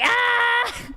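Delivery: a high mock scream; mock panic scream. Imitating a panicked yelp on missing the deadline. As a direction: squeal in fake panic. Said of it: "and I'm just like".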